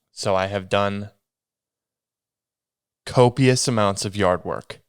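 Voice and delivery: usual speaking voice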